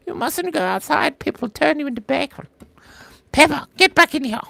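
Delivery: very high cartoon pig-family voice; Peppa Pig. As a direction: squeal out a British cartoon scolding. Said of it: "Do Peppa Pig".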